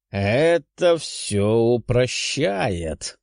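slow, satisfied monster voice; pleased dragon. Acted. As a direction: savour it like a smug beast. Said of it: "вновь рассмеялся необычайно довольный дракон".

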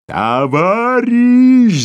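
very high, loud and slow siren howl; siren roar. A narrator's reading. Boss roars at the clerk like a siren. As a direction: wail the word like a siren. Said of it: "заревел, как сирена".

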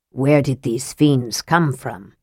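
slow, hushed dread at the monsters; a touch of horror, voice held low; horrified. A narrator's reading. Hornfell, seeing the Draconian, asks in mild horror. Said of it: "Hornfell asked, horrified".

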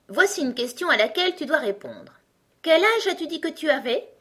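high, insistent, pompous examiner; quizzing egg. A narrator's reading. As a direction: pose the riddle loudly and grandly. Egg character quizzes Alice. (gros coco qui interroge, voix haute)

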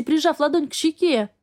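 plain reading voice

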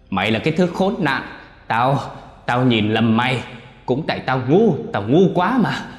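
high, loud, frenzied howl; possessed son howling at his father; screaming. Acted. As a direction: roar it out like a possessed man. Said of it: "chỉ vào chú Phúc mà gào lên".

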